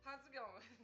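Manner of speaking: ordinary talk, nothing marked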